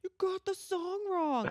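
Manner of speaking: high, drawn-out mimicked griping; mock complaint. Listeners correcting the host's song title, high mock complaint. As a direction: imitate everyone griping, high and slow. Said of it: "Everyone's like".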